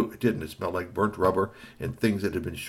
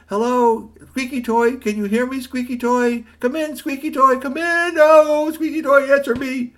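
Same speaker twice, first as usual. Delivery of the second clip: high, loud urgent calling; louder and more desperate calling; 10 dB louder than usual. Acted. Boy calling robot again.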